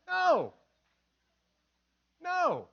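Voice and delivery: loud, high, drawn-out "no"; preacher firmly shutting down the excuse; emphatic rebuke. Real, not acted.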